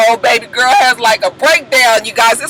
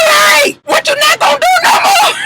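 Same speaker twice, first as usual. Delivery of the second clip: constricted crying yell. Retold or imitated. Baby Knees yelling at Jock, constricted crying shout. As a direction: yell it through a choked-up throat. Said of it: "here came the voice constriction again".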